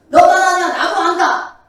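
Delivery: hammed-up annoyed comeback; Job retorting. Preacher imitates Job snapping back at friends, loud. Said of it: "막 이러잖아요".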